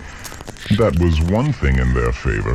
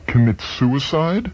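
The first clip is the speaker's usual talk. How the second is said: high disbelieving question; can't believe it, voice jumps; incredulous; 9 semitones higher than usual. Acted. George incredulous at Chuck's story, high jumping question.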